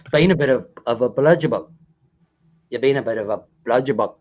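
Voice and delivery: low, quick go at Aussie; attempted Australian accent. Shown as a demonstration. Host practicing with his tutor, low attempted Australian accent. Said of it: "me trying to learn".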